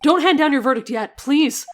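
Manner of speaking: loud, high desperate objection; urgent plea. Acted. Lawyer loudly stopping the judge's verdict. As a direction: stop the judge before the verdict.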